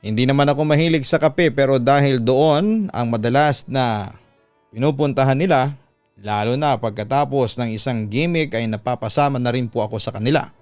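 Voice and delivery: plain reading voice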